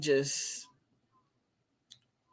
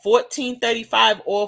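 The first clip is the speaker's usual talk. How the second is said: louder and higher, hyping it; excited; 6 semitones higher, 8 dB louder than usual. Real, not acted. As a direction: sell it with big energy. Hyping the price loudly.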